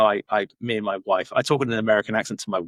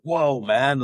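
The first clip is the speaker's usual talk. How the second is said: higher, louder impressed exclamation; enthusiastic American; 5 semitones higher, 5 dB louder than usual. Retold or imitated. American friend hearing wacky stories, enthusiastic. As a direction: imitate an upbeat, wowed friend. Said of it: "enthusiastic about it".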